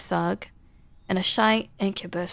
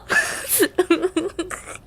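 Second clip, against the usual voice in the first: high laugh breaking out; high burst of laughter; 11 semitones higher than usual. Real, not acted. Player laughs as the game cuts off, high laughter. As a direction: laugh in surprise.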